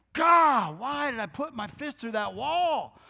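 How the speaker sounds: mock frustrated outburst, loud and high on the first word; acting out an angry man's exasperation; retold exasperation. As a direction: groan out regret after losing your temper. Retold man regretting his rage.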